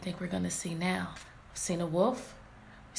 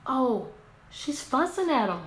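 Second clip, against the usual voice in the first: normal talking voice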